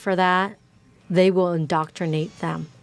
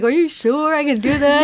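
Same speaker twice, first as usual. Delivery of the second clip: high, loud, fearful self-doubt; anxious doubt; 7 semitones higher, 6 dB louder than usual. Retold or imitated. Hero second-guessing his task, high and anxious.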